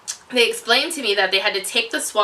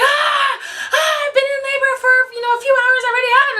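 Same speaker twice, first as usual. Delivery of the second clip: high, loud mock scream of a woman in labor; retold labor scream; 4 dB louder than usual. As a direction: wail it like she's hours into labor. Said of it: "this woman is coming in screaming".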